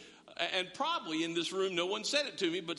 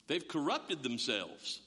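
slow emphasis; 6 semitones lower, slower than usual. Shown as a demonstration. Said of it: "repeated for effect".

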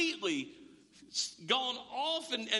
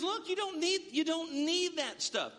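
voicing God; 8 semitones higher than usual. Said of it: "And God says".

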